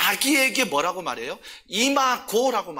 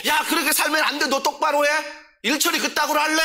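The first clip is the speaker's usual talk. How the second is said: a raised, higher imitation of nagging someone; mock scolding; 7 semitones higher than usual. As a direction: scold like a bossy know-it-all, half-acted.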